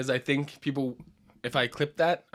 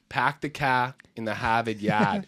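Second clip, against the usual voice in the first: slow, demonstrated Boston accent; broad Boston, every r dropped; 4 semitones lower, slower than usual. Shown as a demonstration. Comic coaching his cohost on the phrase, in slow Boston accent. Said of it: "if you wanna do a Boston accent".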